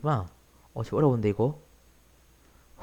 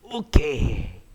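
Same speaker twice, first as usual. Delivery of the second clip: ordinary delivery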